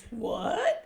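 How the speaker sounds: high rising baffled what; puzzled disbelief; wife confused by a half-asleep husband. Retold or imitated. Confused at odd request.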